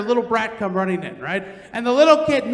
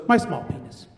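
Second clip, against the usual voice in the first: much lower, flat put-on student voice; low mumbled confession; 11 semitones lower than usual. Comedy student telling the teacher his topic, low put-on voice. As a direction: drop the voice down low and own it. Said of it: "And he goes".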